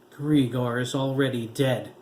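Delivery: loud bitter retort; spat. A narrator's reading. Grandmother spitting her grief at the officer, loud. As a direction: spit the words at him. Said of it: "Madame Zora spat".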